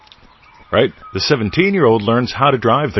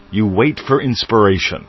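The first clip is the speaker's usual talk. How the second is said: usual speaking voice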